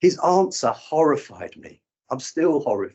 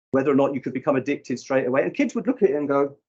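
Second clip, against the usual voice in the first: ordinary talk, nothing marked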